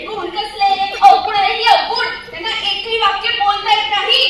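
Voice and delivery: loud scornful laugh at the villagers; loud mockery. Heroine mocking the villagers' talents out loud. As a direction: jeer at them, laughing. Heroine mocks them loudly.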